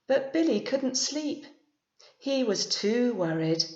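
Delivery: expressive storybook reading, lifting the pitch on key lines; teacher reading a bedtime story; story narration. A narrator's reading. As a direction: read it to a class of young children. Reads picture book aloud.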